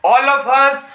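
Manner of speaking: very slow dictation, word by word with long pauses. Shown as a demonstration. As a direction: spell it out slowly so students can write. Teacher dictates definition slowly.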